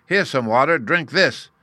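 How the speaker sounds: loud, high, bullying bellow; forceful jeering shout. A narrator's reading. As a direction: bellow it roughly at someone below. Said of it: "Uggug bellowed".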